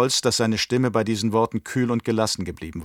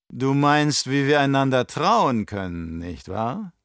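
put-on higher, louder, oily old Baron voice; scheming old Baron; 4 semitones higher, 3 dB louder, slower than usual. Baron to his nephew, raised and sly. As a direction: play the cunning uncle sounding out his nephew.

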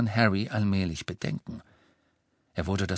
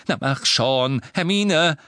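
loud, impatient push; urging; 5 semitones higher, 5 dB louder, slower than usual. Acted. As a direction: hurry her on. Harry urges Hermione.

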